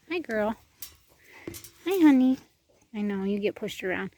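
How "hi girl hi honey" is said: excited greeting. Real, not acted. Greeting an animal affectionately.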